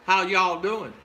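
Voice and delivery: "How y'all doing" run together, Southern style; Southern drawled greeting. Shown as a demonstration. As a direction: greet them in Southern, "y'all" as one syllable. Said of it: "That's Southern".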